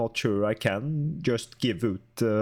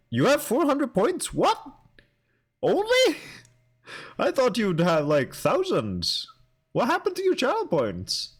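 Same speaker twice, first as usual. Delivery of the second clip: surprised; 13 semitones higher than usual. Real, not acted. Shocked at low channel points.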